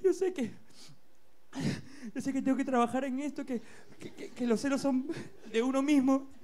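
high, whiny fake crying; mock sobbing apology. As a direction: blubber an apology.

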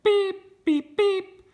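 very high, loud little horn beep; squeaky beep. Acted. Parent voicing the little truck, a high squeaky beep. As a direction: toot it like a tiny truck horn.

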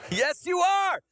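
excited shout. Real, not acted. Preacher affirming the congregation.

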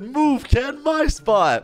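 high, urgent protest; exaggerated squawk of complaint; mock indignation. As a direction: imitate a player shooing a rival away. Acting out player's protest.

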